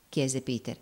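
ordinary delivery

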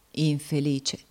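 ordinary delivery, nothing marked

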